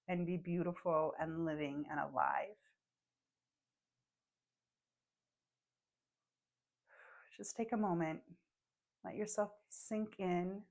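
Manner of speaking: calm guide voice, letting each phrase settle; gentle meditation voice; guided meditation. Acted.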